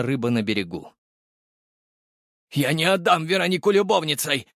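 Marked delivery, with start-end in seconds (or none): 2.5-4.5 high, strained indignant refusal; father's anguished objection; forced-out protest. A narrator's reading. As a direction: force the protest out, pitch raised. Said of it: "наконец выдавил Требухов".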